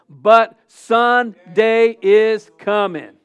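loud, high, slow proclamation; preacher's triumphant refrain. Retold or imitated. Preacher quotes Lockridge's Good Friday sermon, triumphant proclamation. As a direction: boom it out with every word drawn out.

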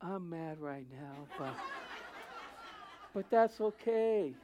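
soft, slow, half-laughing calm; a gentle laughing "I'm mad right now"; meek person laughing off their anger; meek mock anger. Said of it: "Very humbling, even when they were mad".

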